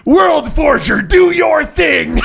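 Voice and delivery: high, loud, slow summons; villain calling on the World Forger, loud and high; commanding shout. Acted. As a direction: call your champion to strike.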